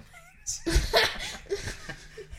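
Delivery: high, loud laughing fit; burst of laughter. Real, not acted. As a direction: crack up helplessly at a joke. Cracks up at the meme.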